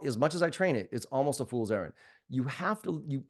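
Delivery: ordinary delivery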